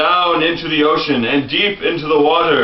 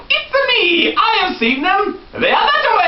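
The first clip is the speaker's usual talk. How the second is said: much higher, excited Luigi voice; high cartoon Luigi; 14 semitones higher than usual. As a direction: squeak it out like a cartoon plumber.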